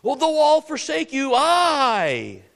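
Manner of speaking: high, loud brash boast; boastful Peter. Retold or imitated. Peter loudly boasting to Jesus he will never fall away. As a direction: Peter crowing his own loyalty. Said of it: "Peter got it flipped upside down".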